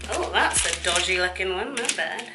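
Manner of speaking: normal talking voice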